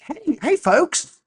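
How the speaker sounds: high-pitched peppy promo pitch; mock call to action. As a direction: hail the listeners like an overeager host. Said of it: "a call to action like".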